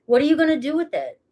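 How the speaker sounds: usual speaking voice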